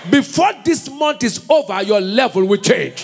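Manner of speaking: high, loud, fiery declaration; loud prophetic declaration; preaching shout. Real, not acted. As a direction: proclaim it over the crowd at full force. Preacher declaring over congregation.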